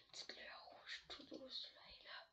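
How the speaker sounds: chuchotement de conteur, tout bas; chuchoté. A narrator's reading. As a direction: murmure pour créer le suspense. Ambiance de suspense dans l'histoire. (chuchote pour faire monter la tension)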